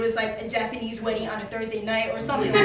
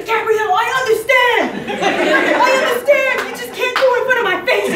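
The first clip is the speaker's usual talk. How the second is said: high, loud boss impression; imitating Ron; 11 semitones higher, 10 dB louder than usual. Retelling the boss's reply to Gabrielle, high loud imitation. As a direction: mimic an exasperated boss, pitched way up. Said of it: "he really talked like that".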